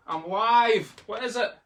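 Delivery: high, loud call to someone at the door; real interrupted-on-air shout. Real, not acted.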